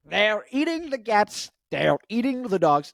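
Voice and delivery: hammed-up Trump rally line; Trump impression. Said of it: "Trump impression".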